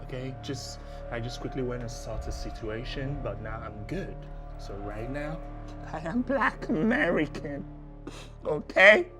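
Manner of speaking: soft and low, begging to be believed; quiet pleading. Acted. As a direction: hush it down and plead. Scammer pleading after exposure.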